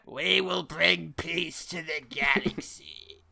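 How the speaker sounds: very slow, grave evil decree; slow villain proclamation. Acted. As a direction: intone it like a smug tyrant. Villain voices continued.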